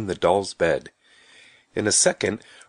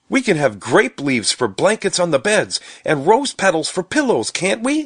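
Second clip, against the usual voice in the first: put-on high, eager little-boy voice; excited; 9 semitones higher, 4 dB louder than usual. A narrator's reading. Stubby planning beds for the doll house, excitedly. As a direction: tumble out the plan with rising excitement. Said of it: "said Stubby excitedly".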